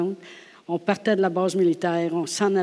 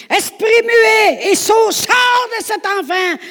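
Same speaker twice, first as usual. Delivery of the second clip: much higher, louder, slow exorcism shout; commanding shout; 9 dB louder, slower than usual. Acted. Jesus shouting the spirit out of the boy. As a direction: order the spirit out.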